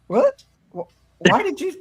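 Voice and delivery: high, startled disbelief at mom; retelling her shock at mom's UFO remark, high and startled; shocked what. Retold or imitated. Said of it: "and I'm like".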